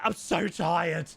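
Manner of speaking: slow, drawn-out sleepy moan; tired whine. Retold or imitated. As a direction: drag it out like you're half asleep. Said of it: "and I'm like".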